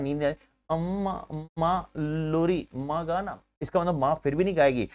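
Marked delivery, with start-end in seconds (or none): 0.0-3.4 put-on softer, slow sleepy child begging mother; loving child asking. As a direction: coo it like a sleepy little kid asking for a lullaby. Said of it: "प्यार से बच्चा क्या बोलता है". (प्यार से, नींद में बच्चे की धीमी, नरम आवाज़)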